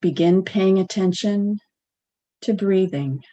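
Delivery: unhurried meditation guide, long pauses; guided meditation. Acted. Practitioner guiding a sitting meditation at the center, spacious guided delivery. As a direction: guide them gently, leaving space between phrases.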